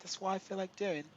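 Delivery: high-pitched, put-on British accent. Streamer defending his right to fly, in a British accent. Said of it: "doing the British accent".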